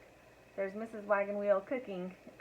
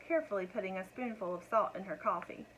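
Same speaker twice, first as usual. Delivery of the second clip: ordinary delivery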